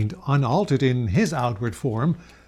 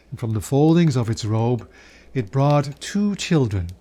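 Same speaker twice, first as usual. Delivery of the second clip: plain reading voice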